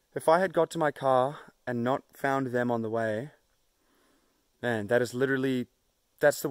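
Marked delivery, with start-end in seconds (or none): none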